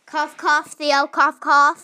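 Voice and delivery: loud spoken cough cough, naming him on purpose; pointed fake cough. Kid coughing out a name to tattle on Theo, loud. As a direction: say cough loudly to tell on Theo.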